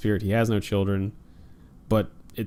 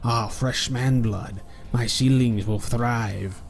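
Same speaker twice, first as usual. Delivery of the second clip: creepy monster voice; slower than usual. Acted. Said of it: "It's just".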